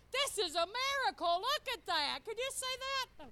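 loud, high, amazed; excited exclaiming. Retold or imitated. She teases her husband, loud and amazed. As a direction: marvel loudly at the miracle. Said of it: "He said".

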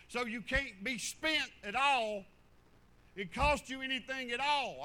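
loud, rising pulpit rebuke; fiery sermon scolding; full-force preaching. Real, not acted. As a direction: preach hard at the proud preacher.

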